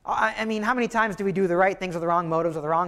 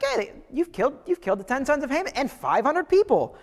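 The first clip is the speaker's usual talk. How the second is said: casual, higher shrug of a voice; flippant king; 6 semitones higher than usual. Retold or imitated. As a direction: say it like a passing remark. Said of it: "He's like".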